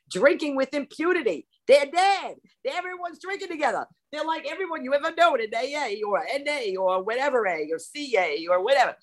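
gleeful high telling. Acted. Gleeful vision of dead drinkers.

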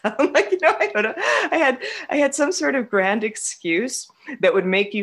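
amused laughter breaking into the sentence; laughing. Real, not acted. Laughing at past self.

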